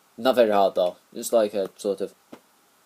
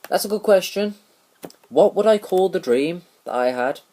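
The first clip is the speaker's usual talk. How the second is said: surprised; 9 semitones higher, 4 dB louder than usual. Real, not acted. Surprised self-question.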